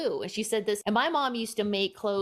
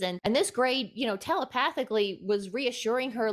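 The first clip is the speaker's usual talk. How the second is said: ordinary talk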